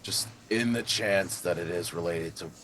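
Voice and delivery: low, pensive character voice; low-voiced game character. Player guessing at the mystery in character, low and pensive. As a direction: speak low, pausing between guesses. In-character speculation, lower than own chat.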